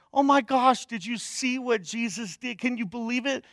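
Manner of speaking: high, excited disciple gushing; amazed disciples. Pretend. A disciple tells the others about the miracle, high and thrilled. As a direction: gasp it out like you just saw a miracle.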